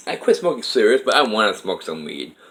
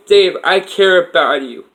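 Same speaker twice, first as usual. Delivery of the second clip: high, loud, slow imitated woman's voice, tender; Kathy's voice; 10 semitones higher, 7 dB louder than usual. Kathy's voice reassuring Dave, high and slow. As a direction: raise into her voice and slow it down. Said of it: "She goes".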